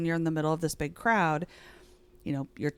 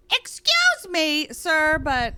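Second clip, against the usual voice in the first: very high, loud, drawn-out indignation; mock shrill voice; 11 semitones higher, 8 dB louder, much slower than usual. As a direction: put on an affronted shriek, stretching each word. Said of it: "with that shrill voice".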